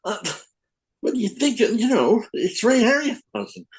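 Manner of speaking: imitating Frank brushing off a silly question; mock duh tone. Frank Miller answers Bob's question, imitated as high mock-obvious duh. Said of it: "he just went".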